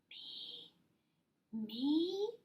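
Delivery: tiny, shy whispered voice. A narrator's reading. Possum shyly whispering to Fox. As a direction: barely breathe it out, timid. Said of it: "whispered Possum shyly".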